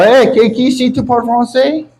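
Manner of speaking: high-pitched burst of French; showy high French question; French-speaking. Acted. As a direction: toss out French in a high, put-on voice. Mock French speaker showing off on stage.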